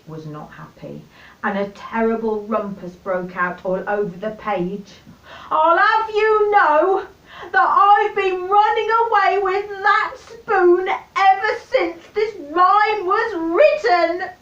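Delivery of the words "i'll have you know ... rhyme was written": high, loud indignant scream; screaming dish. Acted. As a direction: shriek it, offended and tearful. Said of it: "screamed the dish".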